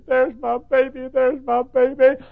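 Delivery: high, loud, slow calls of joy; mother crying out. Retold or imitated. Mother seeing her baby in heaven, joyful cry. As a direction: call out to a child found again.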